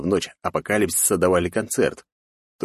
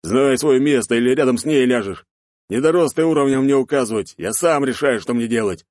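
menacing shout; 6 semitones higher, 4 dB louder than usual. A narrator's reading. Bearded man threatens partner.

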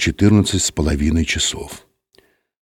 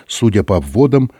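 usual speaking voice

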